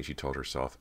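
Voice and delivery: ordinary delivery, nothing marked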